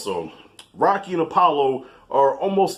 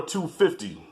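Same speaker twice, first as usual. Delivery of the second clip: ordinary talk, nothing marked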